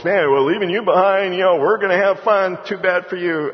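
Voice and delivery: loud, high gloating taunt; jeering drivers. Acted. Departing drivers jeer at the one left behind, loud and gloating. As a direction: crow as you drive off and leave him.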